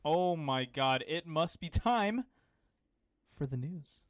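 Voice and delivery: slow, theatrical build-up; hammed-up dawning excitement; mock realization. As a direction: stretch it out like a staged cue. Co-host hams up segment cue.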